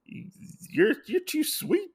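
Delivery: hammed-up lovestruck voice; gushing Gen. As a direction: coo it like he's smitten. Gen gushing over Kitsune.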